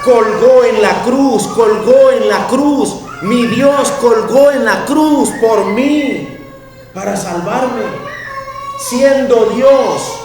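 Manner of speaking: loud, high, slow proclaiming; full-force preaching. Acted. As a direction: thunder it at the congregation.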